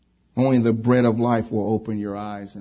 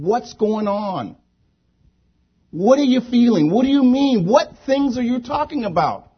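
high-pitched, raised-voice questions; insistent questioning; 11 semitones higher, 5 dB louder than usual. Retold or imitated. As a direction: press them with high, ringing questions. Acting out Jesus questioning the disciples.

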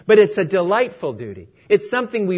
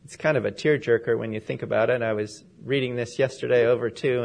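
usual speaking voice

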